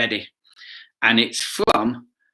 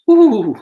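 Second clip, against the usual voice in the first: delighted whispered ooh; 12 semitones higher, 9 dB louder than usual. Real, not acted. Delighted by the idea of cake.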